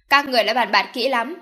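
loud, indignant question; angry. A narrator's reading. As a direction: burst out at the mother in anger. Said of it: "nổi giận đùng đùng nhìn mẹ". (giận dữ chất vấn)

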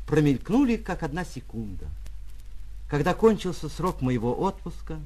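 ordinary delivery, nothing marked

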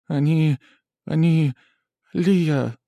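high, halting and trembling. A narrator's reading. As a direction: stammer it out in shock. Shocked girl after the massacre.

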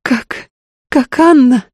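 high-pitched, desperate woman's plea; imploring. A narrator's reading. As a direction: beg for rescue, distraught. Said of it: "умоляюще схватила за руку".

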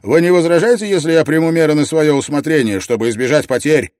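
loud, high, rapid formal question; military request. A narrator's reading. Scharführer asks his captain for permission, loud and brisk. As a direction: rattle off a request to an officer.

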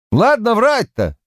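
high, sharp disbelieving scoff; indignant outburst. A narrator's reading. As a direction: scoff at him, voice jumping up. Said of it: "Полвард мало-помалу закипал".

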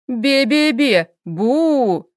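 put-on childish slow taunt; teasing raspberry. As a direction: stick your tongue out as you say it. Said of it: "показала язык спине Хела".

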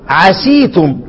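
"عسيتم" with a long ee after the seen, "asee-tum"; kasra on the seen. Shown as a demonstration. Teacher showing one reader's variant, "عسيتم" said with an ee vowel. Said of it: "يقرأ ايه؟".

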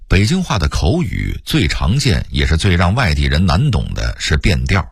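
normal talking voice

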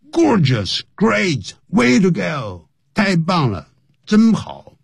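bright high cheer after a good speech; congratulating a speaker who just stepped down; enthusiastic praise. Shown as a demonstration. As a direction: cheer them on as they step off stage.

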